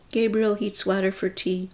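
plain reading voice

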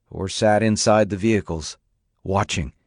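ordinary talk

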